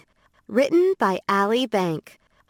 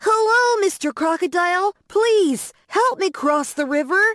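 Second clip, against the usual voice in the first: bunny calling out; 10 semitones higher than usual. A narrator's reading. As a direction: shout up to the crocodile in a high bunny voice. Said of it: "It yelled".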